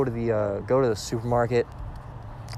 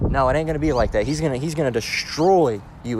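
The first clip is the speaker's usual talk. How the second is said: usual speaking voice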